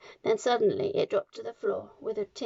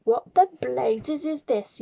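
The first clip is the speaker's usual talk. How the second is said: slow, louder, outraged demand; yelling; 3 semitones higher, 5 dB louder, slower than usual. A narrator's reading. Trunchbull sees her name written, yelling. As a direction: bellow in disbelief. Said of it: "yelled the Trunchbull".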